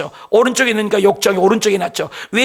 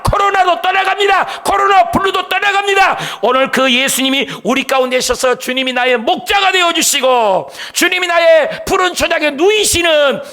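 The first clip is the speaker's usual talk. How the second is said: fiery preaching; 5 semitones higher than usual. Acted. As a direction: preach it out at full force to the congregation. Preacher's rising climax.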